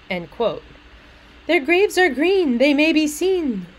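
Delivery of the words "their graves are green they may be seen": higher, louder lilting verse; sing-songy. Acted. Little maid answering about the graves, sing-songy. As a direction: recite it like a childish rhyme. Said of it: "the tone is sing-songy".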